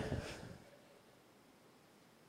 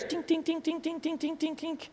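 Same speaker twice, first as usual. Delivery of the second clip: high, soft tink tink tink; tiny metallic clinks of folding chairs; chair clink noises; 11 semitones higher, 11 dB quieter than usual. Retold or imitated. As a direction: mimic chairs clinking into place.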